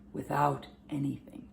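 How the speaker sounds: soft, breath-only ending; fading whisper. Shown as a demonstration. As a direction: let the words fade into a whisper. Ending of sans everything, fading away.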